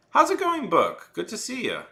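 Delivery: very high, loud and bubbly hello; high cheerful welcome; excited greeting. Real, not acted. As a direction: greet a friend warmly and brightly.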